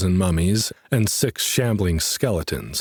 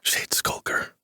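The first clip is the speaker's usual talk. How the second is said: whisper; 5 dB quieter, slower than usual. A narrator's reading. Hiding from a monster.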